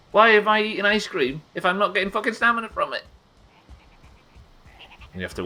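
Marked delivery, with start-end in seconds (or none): 0.1-3.0 exasperated rant. Real, not acted. Game character runs out of stamina.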